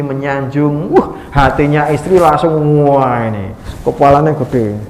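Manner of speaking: ordinary talk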